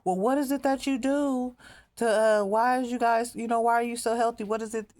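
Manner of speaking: high, curious mock-innocent questioning voice; prying callers. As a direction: play the nosy caseworkers asking sweetly. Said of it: "they started calling".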